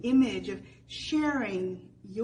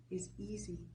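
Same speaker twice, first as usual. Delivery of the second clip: breathy whisper, almost no voice; whispered tenderly; near whisper; 4 semitones lower, 11 dB quieter than usual. Acted. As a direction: let it fall to a whisper. Hushed climax of scripture reading.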